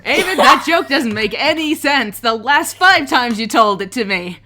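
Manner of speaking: loud, exasperated complaint; loud exasperation. Acted. Fellow character rebuking Eamon over a stale joke, loudly exasperated. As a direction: raise the voice, fed up with the joke.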